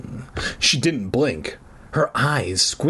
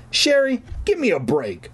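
high exasperated cry on the name; exasperated protest; 11 semitones higher than usual. A narrator's reading. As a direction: whine it in exasperation, starting high. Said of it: "I rolled my eyes".

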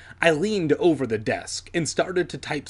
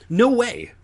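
curt interruption; 10 semitones higher, 5 dB louder than usual. A narrator's reading. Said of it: "Alex interrupted".

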